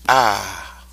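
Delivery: long open "Ah" held out; drawn out ah. Shown as a demonstration. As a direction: hold the vowel "Ah". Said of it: "The first sound goes like this".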